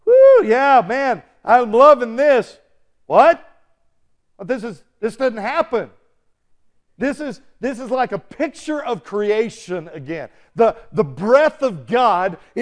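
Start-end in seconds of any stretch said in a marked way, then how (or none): 0.1-2.6 loud, high whooping delight; cheering viewers. Acted. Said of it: "They're, like, going". 3.1-9.0 stunned disbelief. Acted. Viewers acted out in disbelief.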